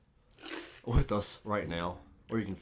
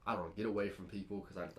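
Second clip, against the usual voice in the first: normal talking voice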